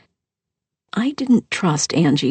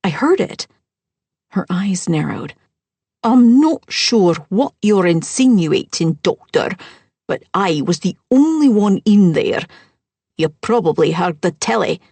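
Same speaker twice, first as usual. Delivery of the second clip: stiff, louder lie; defensive stepmother; 2 semitones higher, 4 dB louder than usual. Acted. Characters clash over the overheard man.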